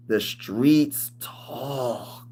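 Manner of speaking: very slow, soft, ominous put-on voice; changed voice. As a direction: drag it out like a dramatic warning. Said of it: "Y'all like how I be changing my voice?".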